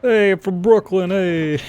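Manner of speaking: a slow, lightly done Brooklyn accent attempt. Shown as a demonstration. A friend demonstrates a weak Brooklyn accent on request. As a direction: try your best Brooklyn accent, slowly. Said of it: "It's the best I can do".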